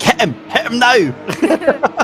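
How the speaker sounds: high, slow urging shout; egging the driver on from the stands; eager spectator yell. Retold or imitated. Spectator urging the driver to attack, drawn-out yell. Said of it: "you're like".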